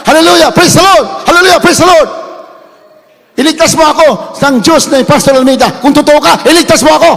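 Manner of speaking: high, loud pleading shouts of praise; desperate shouted prayer. Retold or imitated. Captive begs God to save him, loud desperate shouting. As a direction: scream for God to save you. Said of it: "Sigaw siya na sigaw".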